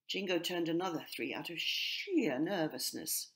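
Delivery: ordinary delivery, nothing marked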